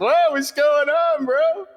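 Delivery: high, slow, shouted whoa; excited greeting. Retold or imitated. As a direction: call it out big and happy. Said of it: "and I was like".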